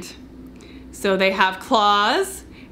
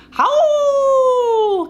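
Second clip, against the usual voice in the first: a long high coyote howl, louder; 4 dB louder than usual. Shown as a demonstration. Teacher showing kids how coyotes call, long high howl. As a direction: howl like a coyote calling to its pack. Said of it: "They go".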